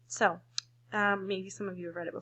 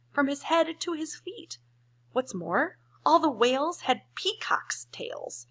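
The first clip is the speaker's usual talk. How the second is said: lightly voiced pleading birds, louder; 5 semitones higher, 5 dB louder than usual.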